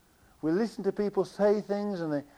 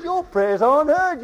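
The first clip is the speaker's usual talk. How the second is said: raised, loud mock retort; emphatic rebuff; 8 semitones higher, 8 dB louder than usual. Preacher re-enacting Finney's rebuff to the meeting, loud and emphatic. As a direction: burst out high with the accusation.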